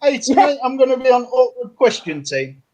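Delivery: a rough go at Geordie; Geordie accent. Shown as a demonstration. Panellist trying an accent for the host, Geordie accent. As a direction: have a crack at a Geordie accent. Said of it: "Yeah, Geordie. Go for Geordie".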